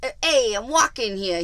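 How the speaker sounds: loud New York tough guy; brash wiseguy shouting at traffic; mobster voice. Retold or imitated. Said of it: "'cause I'm sounding like a mobster".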